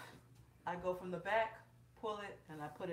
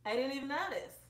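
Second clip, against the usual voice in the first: higher and a bit louder mock innocence; mock surprised viewer; 8 semitones higher, 5 dB louder than usual. Vlogger mimicking viewers who never noticed her corset, mock surprise. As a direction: play the viewer who missed it. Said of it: "And I know you're like".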